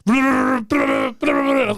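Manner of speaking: high, stretched-out panicked yell; panicked shout. Retold or imitated. Retelling a panicked phone mistake, high stretched yell. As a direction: wail it out in alarm.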